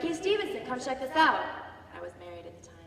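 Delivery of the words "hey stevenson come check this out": high, loud call to a friend. Retold or imitated. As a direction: call him over across the deck. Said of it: "my friend yells to me".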